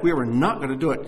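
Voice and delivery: ordinary talk, nothing marked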